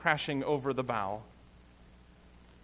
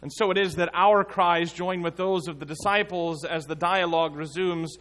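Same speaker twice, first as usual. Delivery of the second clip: ordinary talk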